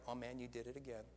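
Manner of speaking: a quiet mock sneer at yourself. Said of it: "with a sneer".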